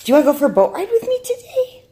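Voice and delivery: fast, very high, loud question; excited pet talk. Real, not acted. Owner luring the dog with a boat ride, excited pet talk. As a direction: squeal it temptingly to your dog.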